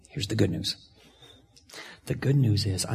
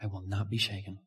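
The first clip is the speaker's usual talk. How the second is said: much softer, settled reading; soft scripture quote; 3 semitones lower, 12 dB quieter than usual. Acted. Preacher closing on the verse for congregation, hushed. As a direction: let it land quietly.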